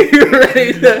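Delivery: words through laughter, high and loud; real laughter breaking in; laughing. Real, not acted. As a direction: laugh while starting the line. Laughing at the story.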